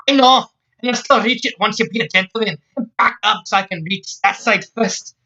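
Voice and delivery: high, insistent Scots woman; Scots accent. Acted. Scotswoman telling Jack to back up, insistent Scots accent. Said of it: "she said in a Scots accent".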